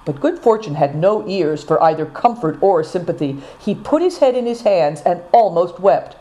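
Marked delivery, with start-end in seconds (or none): none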